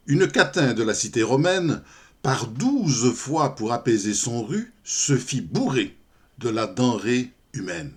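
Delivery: formal verse reading with lilting line ends; poem recital. Acted. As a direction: recite the poem theatrically, lingering on each line. Recites the poem after introducing it.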